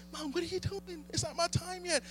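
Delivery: high, hushed protest to mom; embarrassed son. Acted. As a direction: object under your breath in a high little voice. Voices Jesus protesting to mom.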